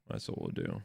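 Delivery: breathy whisper. Pretend. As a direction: whisper it to yourself. Muttering fix plan to self.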